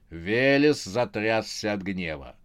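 plain reading voice

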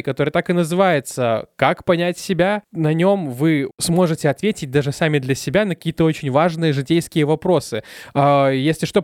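normal talking voice

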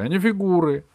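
put-on high, small child voice; little girl. A narrator's reading. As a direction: speak like a timid little girl. Little girl's voice. (голосок маленькой девочки)

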